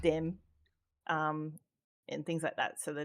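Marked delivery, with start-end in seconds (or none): 0.0-0.4 "dem" with a hard d for th; "dem" the Cajun way, d instead of th. Shown as a demonstration. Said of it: "they speak a lot of".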